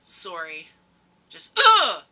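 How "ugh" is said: loud, high-pitched groan of disgust; sudden exasperated outburst. Real, not acted. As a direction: let out a frustrated ugh. Exasperated outburst after apologizing.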